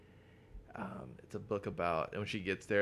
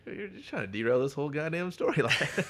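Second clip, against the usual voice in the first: laughing complaint; 7 semitones higher, 5 dB louder than usual. Real, not acted. Laughing complaint about derailing.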